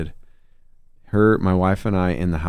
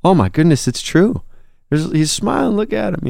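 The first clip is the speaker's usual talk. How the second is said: much higher, choked-up imitation of a crying friend; telling how a friend teared up at the photo, in a higher, emotional voice; retelling a friend's tearful reaction; 10 semitones higher than usual. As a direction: play back how your friend got emotional over the photo. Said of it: "and he starts crying".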